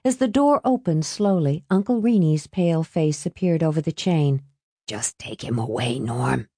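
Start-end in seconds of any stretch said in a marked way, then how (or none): 4.9-6.5 low, soft furtive whisper; hushed low plea. A narrator's reading. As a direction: keep it hushed so nobody else hears. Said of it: "he whispered".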